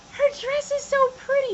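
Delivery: put-on high, breathless child's awe; little girl voice. Little girl admiring a stranger's Lolita dress, high awed gush.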